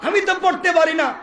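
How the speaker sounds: high-pitched, pressing reply; insistent repeated protest. Acted. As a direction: protest with the voice pushed right up. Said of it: "আবার বলছেন".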